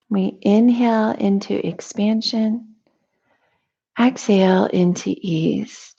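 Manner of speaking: calm, measured meditation voice with long pauses; guided meditation. Real, not acted. Teacher leading listeners through a morning breathing meditation, calm guided delivery. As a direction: guide them gently into noticing breath and body, unhurried.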